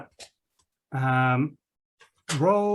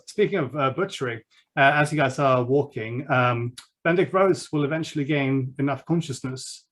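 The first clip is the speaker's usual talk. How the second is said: normal talking voice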